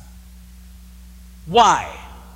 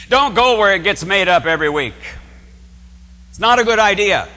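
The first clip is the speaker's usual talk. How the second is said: louder, pressing the point home; forceful warning; 5 semitones higher, 5 dB louder than usual. Real, not acted. Priest warning visitors away from made-up worship, forceful and louder. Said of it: "preacher warns visitors".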